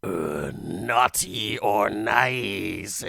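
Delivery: very slow, drawn-out creepy Santa; imitating a sleazy Santa villain; leering dirty Santa. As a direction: stretch it out with a wicked grin and cackle. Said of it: "all smiling with his meth teeth".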